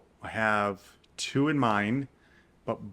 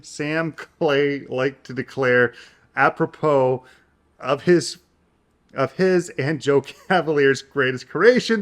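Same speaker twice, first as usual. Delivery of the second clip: louder, dramatic read of a long sentence; reading aloud; 3 semitones higher, 7 dB louder than usual. Acted. She reads the first line aloud, growing louder and dramatic. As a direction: perform the opening line.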